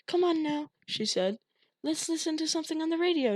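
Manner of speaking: high, fast bright mother voice; mother distracting her son; forced cheer. A narrator's reading. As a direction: cover fear with cheer.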